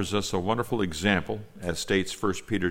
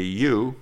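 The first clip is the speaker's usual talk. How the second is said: "You" punched louder and a touch higher; emphatic you; 4 semitones higher, 6 dB louder than usual. Shown as a demonstration. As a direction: hit "You" hard to show the emphasis. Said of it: "it's an emphatic you".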